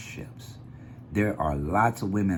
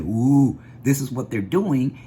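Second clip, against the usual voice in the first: a mocking gasp of panic, louder; mock hysteria; 5 semitones higher, 5 dB louder than usual. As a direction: play the panicked alarmist, hammed-up. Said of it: "hysterical people running around saying".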